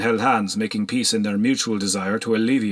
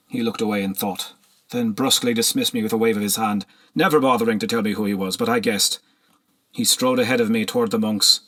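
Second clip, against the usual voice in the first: ordinary delivery, nothing marked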